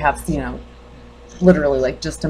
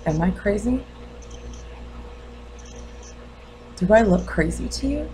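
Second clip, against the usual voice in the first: restrained questioning, long pauses between lines; lightly played grieving mother, guarded and probing; wounded mother challenging the doctor; 3 semitones higher, 6 dB quieter than usual. Mother asks doctor if she looks crazy, quietly challenging. Acts monologue of bereaved mother.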